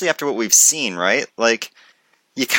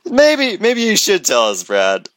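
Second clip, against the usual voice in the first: teasing high maybe; 9 semitones higher than usual. Real, not acted.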